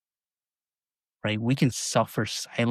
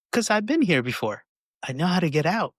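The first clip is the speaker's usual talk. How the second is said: higher, reassuring explanation; lightly voiced Bob; 7 semitones higher, 4 dB louder than usual. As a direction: explain you know the way out.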